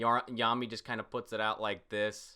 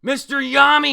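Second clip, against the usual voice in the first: much higher, loud calling voice; villain calling out; 17 dB louder than usual. Acted. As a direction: call his name up high and loud. Voicing a villain's greeting.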